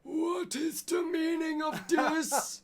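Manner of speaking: hammed-up indignant shout; outraged shout. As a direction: shriek the question in outrage. Shocked character bursts out.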